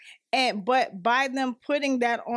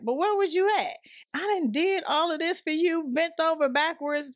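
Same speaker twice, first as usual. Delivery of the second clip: higher-pitched, indignant reproach; indignant confrontation; 7 semitones higher than usual. Retold or imitated. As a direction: call them out, aggrieved. Imagined confrontation with users.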